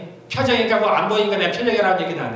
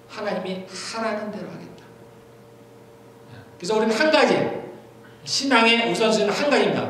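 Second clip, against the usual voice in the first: ordinary talk, nothing marked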